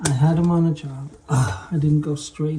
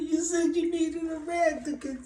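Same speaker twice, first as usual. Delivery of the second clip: high squeaky voice, softer, broken by laughter; laughing through it; 13 semitones higher, 7 dB quieter than usual. Retold or imitated. Boss cracking up while mimicking the helper in a high voice. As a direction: try to say it but crack up laughing.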